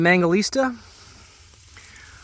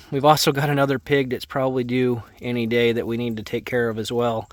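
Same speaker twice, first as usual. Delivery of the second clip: ordinary talk, nothing marked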